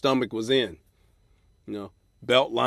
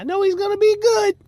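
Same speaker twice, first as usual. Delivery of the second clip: very high, sugary falsetto fan; gushing fan; 10 dB louder than usual. Retold or imitated. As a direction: gush like an eager fan after the show.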